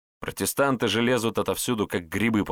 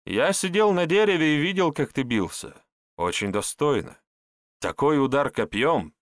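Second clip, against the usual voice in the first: admiring; 7 semitones higher than usual. Acted. Herbalist admiring Andrey's fight.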